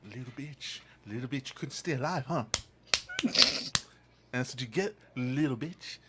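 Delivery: a hushed, gloating taunt; mock taunting. Taunting dead friend at grave.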